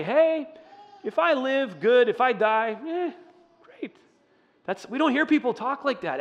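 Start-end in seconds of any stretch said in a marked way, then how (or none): 0.0-4.0 light and high, shrugging off death; breezy, carefree. Pretend. Pastor voicing a carefree shrug at dying, breezy and high. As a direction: toss it off like it's nothing. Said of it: "People don't say".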